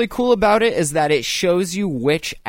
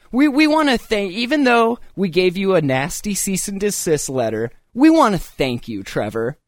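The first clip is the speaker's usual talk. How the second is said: sarcastic villain paraphrase; 5 semitones higher than usual. Pretend. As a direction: sneer the thanks like a smug villain.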